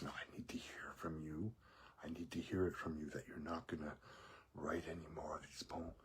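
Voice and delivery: hushed, breathy insistence; whispering. Retold or imitated. Coworker demanding a promise about the poems, whispered. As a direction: whisper it, pressing the point. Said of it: "He goes".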